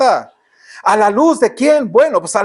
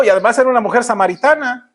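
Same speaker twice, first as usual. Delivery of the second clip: ordinary talk, nothing marked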